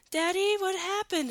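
put-on high-pitched child's question; little kid. Kid asking daddy in a cheesy movie, childlike. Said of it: "like, a little kid asks".

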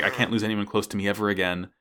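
normal talking voice

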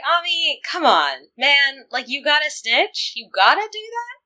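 exasperated plea. Retold or imitated. Host scolding Ami for snitching on Usagi, exasperated high whine. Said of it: "I was just like".

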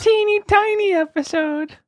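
squeaky tiny high voice; tiny faraway voice. Acted. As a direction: shrink your voice to something tiny and far off. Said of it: "that's little teeny tiny episode's voice".

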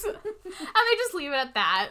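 ordinary talk, nothing marked

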